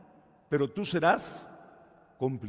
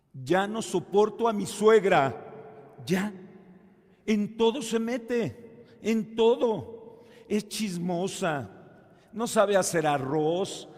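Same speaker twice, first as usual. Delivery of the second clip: a bit higher and louder, slow exasperated whine; complaining young woman; 6 semitones higher, 6 dB louder, slower than usual. Retold or imitated. Young woman complaining to the priest about her mother-in-law, exasperated. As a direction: dump your frustration on the priest. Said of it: "llegó una chica y me dijo".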